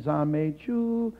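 slow "Zan Mei Ju" with "Ju" held level; wrong first tone. Shown as a demonstration. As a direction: keep "Ju" on one steady pitch. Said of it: "first tone".

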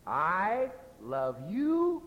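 insincere I love you. Shown as a demonstration. Preacher demonstrating how tone contradicts the words, insincere. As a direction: stretch each word upward, meaning the opposite. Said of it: "my meaning is the exact opposite".

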